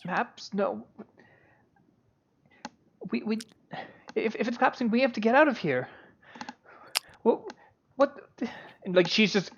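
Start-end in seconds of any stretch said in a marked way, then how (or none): 0.0-8.2 higher, halting panicked stammer; confused, panicking. Acted. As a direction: stammer in flustered confusion, voice raised in pitch. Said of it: "confused and panicking right now".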